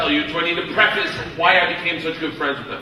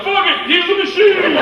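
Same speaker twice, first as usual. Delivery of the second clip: high, loud roar of acclaim; rowdy cheer; 11 semitones higher, 5 dB louder than usual. Retold or imitated. Gangsters hailing their new American friend, rowdy shouted cheer. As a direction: holler it like a whole drunken gang.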